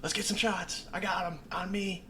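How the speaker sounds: high, eager rallying; eager shot call. Retold or imitated. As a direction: hype the group for shots.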